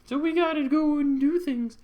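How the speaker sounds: high, loud put-on voice; rally them in a high, hammed-up voice; acted friends. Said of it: "They're like".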